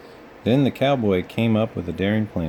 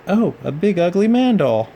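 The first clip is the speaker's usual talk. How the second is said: put-on high, louder little girl delight; 10 semitones higher, 5 dB louder than usual. A narrator's reading. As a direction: gush like a small girl finding a doll. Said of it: "said the girl".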